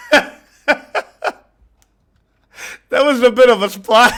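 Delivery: breaking into giggles mid-story, high and loud; can barely get the words out for laughing. Real, not acted. Telling viewers about the melon splash, helplessly laughing.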